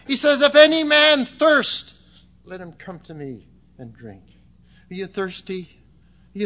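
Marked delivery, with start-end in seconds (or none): 0.1-1.9 loud, raised call to a crowd; crying out. Acted. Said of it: "He's crying to that". 2.4-4.2 tender invitation. Acted. Tender close of Christ's invitation.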